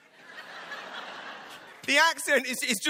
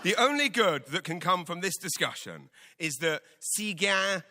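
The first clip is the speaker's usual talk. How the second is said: ordinary talk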